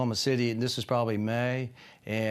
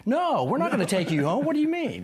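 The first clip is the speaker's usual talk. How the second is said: higher, louder, incredulous protest; indignant disbelief; 11 semitones higher, 3 dB louder than usual. Retold or imitated. Girlfriend refusing his ride request, higher incredulous protest. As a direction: scoff at him as if the request is absurd. Cindy refusing to drive him home.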